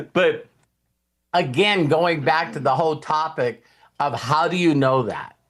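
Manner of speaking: normal talking voice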